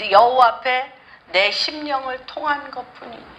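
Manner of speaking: ordinary talk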